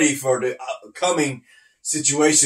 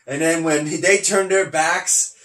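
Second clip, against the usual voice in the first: ordinary talk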